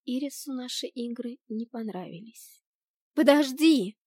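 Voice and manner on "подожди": higher, louder cry to wait; urgent raised-voice call. A narrator's reading. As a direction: call after someone walking away. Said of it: "крикнула я".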